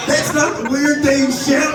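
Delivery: high-pitched comic objection; mock protest. Real, not acted. Mock protest at audience laughing.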